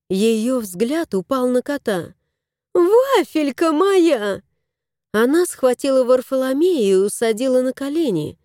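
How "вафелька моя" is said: high, slow gushing; cooing lady. Acted. Lady gushing over her cat. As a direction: coo at a beloved pet.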